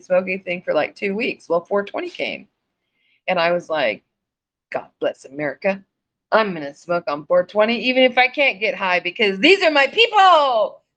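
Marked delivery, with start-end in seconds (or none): 4.7-10.8 building to a high, loud cry; rallying declaration. Retold or imitated. Smoker declaring solidarity on four twenty, rousing. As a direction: proclaim it, rising to a rousing finish. Mock-patriotic declaration of joining friends.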